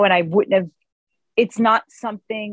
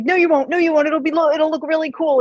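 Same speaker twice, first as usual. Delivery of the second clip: eager reassurance; 7 semitones higher than usual. Retold or imitated. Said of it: "And I was like".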